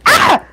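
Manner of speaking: high yelp after a burn; sharp yelp of pain. Real, not acted. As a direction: cry out, suddenly burnt. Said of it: "I just burnt myself".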